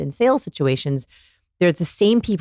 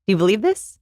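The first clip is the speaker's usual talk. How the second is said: "this" rising into a question; asking the lie; 3 semitones higher than usual. Acted. Voicing liars in a party game, questioning rise. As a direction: lift "this", fishing for belief. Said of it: "they'll ask their lie".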